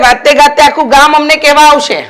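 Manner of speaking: father scolding his son; sharp retort. Acted. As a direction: cut him down with a sharp scold.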